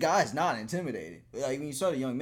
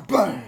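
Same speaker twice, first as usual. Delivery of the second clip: loud, higher shouted bang; mock gunshot; 8 semitones higher, 14 dB louder than usual. Mimes shooting a gun.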